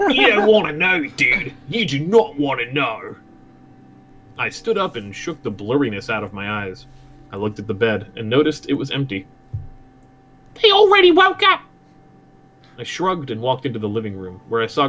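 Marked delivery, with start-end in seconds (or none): 0.1-3.2 lightly exaggerated Australian voice; Australian warning in character; Australian accent. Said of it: "I'll do Australian". 10.6-11.6 very high, loud Australian squawk; shrieky Australian conscience voice; Australian accent. Acted. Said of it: "I'll do Australian".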